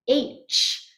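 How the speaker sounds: unvoiced open H, just breath; breathy H sound. Shown as a demonstration. Teacher demonstrates an open breathy H. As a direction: let the H out as pure air. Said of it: "make the sound".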